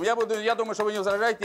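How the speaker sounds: normal talking voice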